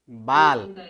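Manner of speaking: बाल as one syllable, stopping on the l; clipped halant ending. Shown as a demonstration. Teacher showing students how the misspelling reads, halant ending. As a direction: say बाल ending dead on the consonant l. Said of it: "ये हलंत वाला आएगा".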